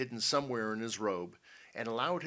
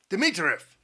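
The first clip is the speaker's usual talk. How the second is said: loud, raised summons; angry shout; 7 semitones higher, 11 dB louder than usual. A narrator's reading. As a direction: shout for your henchman, losing control. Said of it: "shouted the general".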